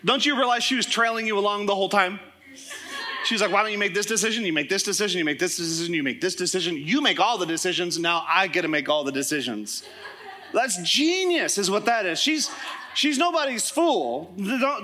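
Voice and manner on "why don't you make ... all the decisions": low, measured run of repeated phrases; lowered pitch, evenly listing one item after another; scheming wife. Retold or imitated. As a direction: imitate a wife coolly laying out her plan. Wife's scheming reply, retold.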